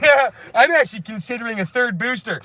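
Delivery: high, cheery caricature voice; mock sheepish diners. Mocking vaccinated friends chatting at dinner, high caricature voice. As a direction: greet friends like a gullible goody-goody.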